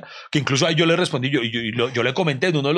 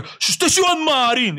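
stranger shouting his name; 12 semitones higher, slower than usual. Retold or imitated. Retold stranger calling out again. (gritar el nombre, agudo, fuerte y lento)

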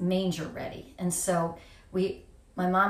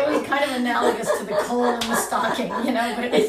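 laughing; 5 semitones higher, 6 dB louder, faster than usual. Real, not acted. Laughing coal in stocking joke.

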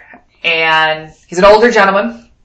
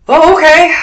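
mock surprise; 10 semitones higher, 6 dB louder than usual. Officers react to Wyoming.

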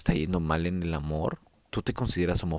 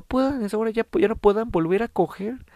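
exaggerated dismay; 5 dB louder than usual. Real, not acted. Exaggerated dismay about STD.

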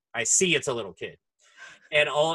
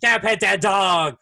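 put-on much higher, louder, slow toddler calling; toddler begging dad; 9 semitones higher, 9 dB louder, slower than usual. Toddler begging his dad to pet dog, loud shout. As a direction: yell it to dad like an eager tot. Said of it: "he literally was like".

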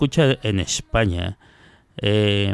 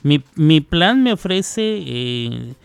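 higher-pitched imitation of a phone customer; usuario de teléfono; 7 semitones higher than usual. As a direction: mimic a user talking up their plan. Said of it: "Entonces, si usted dice". (imitando a un usuario, voz más aguda)

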